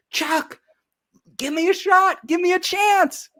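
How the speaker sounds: hammed-up pleading with the boss again; whiny pleading.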